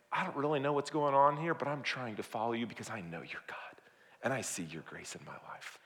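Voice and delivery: hushed prayer, fading to near whisper; small, tentative faith. Acted. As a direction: let it sink softly into a humble confession. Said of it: "somebody with a baby faith".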